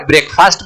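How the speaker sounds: "ब्रेकफास्ट" as break + long faast; wrong pronunciation. Shown as a demonstration. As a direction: keep both words whole in "ब्रेकफास्ट". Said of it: "नहीं, गलत pronunciation है". ("ब्रेकफास्ट" को ब्रेक-फ़ास्ट, दो शब्दों जैसा बोलें)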